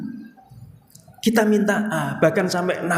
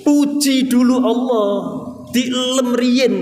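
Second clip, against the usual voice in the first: louder, higher insistence, slowing to stress each word; firm urging; 6 semitones higher, 4 dB louder, slower than usual. Real, not acted. Preacher urging the congregation to praise Allah first, loud and insistent. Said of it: "urging the congregation".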